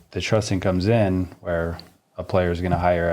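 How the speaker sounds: ordinary talk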